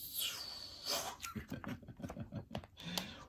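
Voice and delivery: soft unvoiced chuckle; breathy laugh. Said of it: "Oh, how embarrassing".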